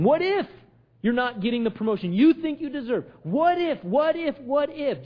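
high, insistent sermon delivery; impassioned preaching. Acted. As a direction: pile up the what-ifs, pitch jumping on each. Preaches with rising what-ifs.

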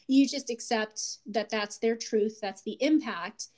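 ordinary talk

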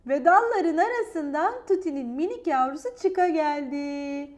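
ordinary delivery

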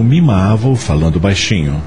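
ordinary delivery

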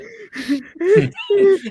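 a sudden high-pitched laugh bursting out; high burst of laughter. Real, not acted. Laughing at the commentator's reaction, loud high laugh. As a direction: crack up loudly at the story.

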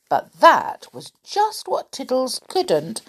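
ordinary delivery, nothing marked

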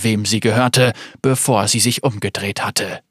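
ordinary delivery, nothing marked

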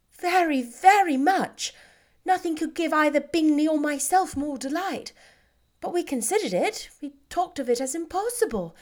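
glad but checking her sister's heart; Jane, delighted. Acted. Jane delighted then probing.

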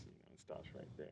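a sly whispered punchline; whispered aside. Real, not acted. Guest whispers the joke to the hosts, voiceless. Joking aside after race comment.

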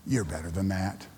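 put-on an older man quietly correcting a young player; gentle rebuke. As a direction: say it kindly, like a mentor. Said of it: "came up afterwards and said".

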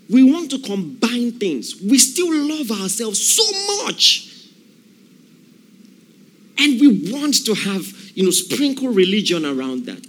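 high, loud preaching; fervent preaching. Acted. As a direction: build the fire of a revival preacher. Preaching at full force.

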